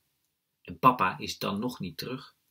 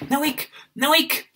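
put-on high, loud small-boy voice; little brother calling out; 10 dB louder, slower than usual. A narrator's reading. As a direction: pipe up loudly as an eager little brother. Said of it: "roept mijn kleine broertje".

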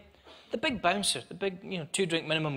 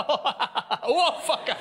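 laughing curse; 9 semitones higher, slower than usual. Retold or imitated. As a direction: blurt it out through laughter. Falling off stool, laughing.

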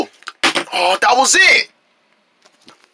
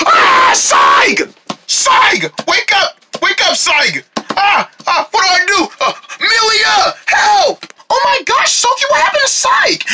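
panicked shouting; 14 semitones higher than usual. Acted. Friend knocked out, panicked calls.

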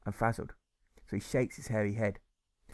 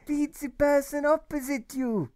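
high, slow, loud urgent call; a boy urging starving diners; urgent instruction; 6 dB louder than usual. Acted. Brainy boy tells the starving diners, high and urgent. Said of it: "he told the people in there".

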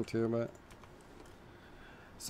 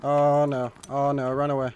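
ordinary talk, nothing marked

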